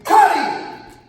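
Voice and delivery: loud, high screamed order. Retold or imitated. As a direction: scream it from the corner. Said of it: "I scream".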